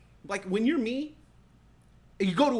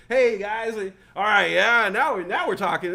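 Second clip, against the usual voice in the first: louder, eager hey guys; loud greeting; 7 dB louder than usual. Retold or imitated. Joining coworkers at the party, loud cheerful hello. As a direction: greet the beer drinkers loudly.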